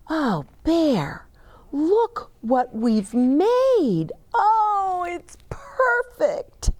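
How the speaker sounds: high, slow, hushed wonder; awed delight. Acted. Boy showing Bear their finished treehouse, awed and high. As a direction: gasp it out in amazement.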